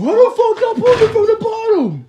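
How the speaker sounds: high, loud mocking screech; mock angry commenter. Vlogger mocking the comments he expects, loud high parody. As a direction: parody a whiny YouTube commenter yelling. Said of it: "how many comments I'm about to get".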